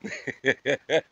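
short rapid chuckle; real amused chuckling; laugh. Real, not acted. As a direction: laugh off your own outburst. Laughs after his outburst.

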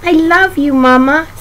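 high small lamb voice; high-pitched, loving little lamb. A narrator's reading. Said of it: "says little lamb".

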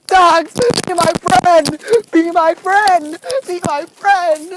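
high, slow, drawn-out begging; pleading call. Acted. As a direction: call out imploringly, stretching each plea. Calling out begging for friendship.

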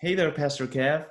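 sing-song hey there to the pastor; playful greeting. Pretend. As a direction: greet him like a cheery fan of his.

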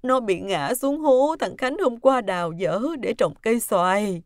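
upset, crying account; tearful. Acted. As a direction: explain the accident, still weeping. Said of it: "lau nước mắt thở dài nói".

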